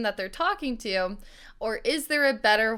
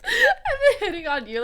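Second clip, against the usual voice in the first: laughing admission; 10 semitones higher than usual. Real, not acted. Host admits flirting while cracking up.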